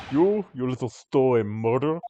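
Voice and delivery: put-on hostile sailor in a heavy Russian accent; Russian sailor accusing a stowaway, sneering.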